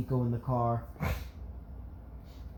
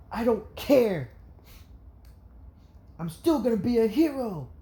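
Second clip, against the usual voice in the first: put-on high, raised child's cry of defiance; defiant outburst; 9 semitones higher, 4 dB louder than usual. A narrator's reading. Izuku answers classmates putting him down, defiant outburst. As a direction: burst out with sudden defiance. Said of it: "he never gives out outbursts".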